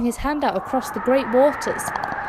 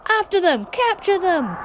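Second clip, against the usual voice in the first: higher, urgent battle command; shouted orders; 7 semitones higher than usual. A narrator's reading. Pharaoh ordering his army to chase them, shouted command. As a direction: drive the army forward with a cry. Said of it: "he shouted".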